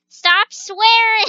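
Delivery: teasing a friend, loud; teasing shout. Real, not acted. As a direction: call him out with a grin.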